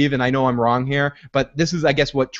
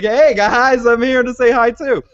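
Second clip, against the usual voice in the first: mock cheerful greeting; 7 semitones higher, 5 dB louder than usual. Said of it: "he's like".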